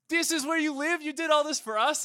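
very high, incredulous questions; hammed-up high-pitched disbelief; astonished king. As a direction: gasp out royal amazement. Acted king impressed by castle.

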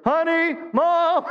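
put-on high, loud childish call; kid calling mom. Kids running to mom, high loud childish call. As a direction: holler for mom like a tattling kid.

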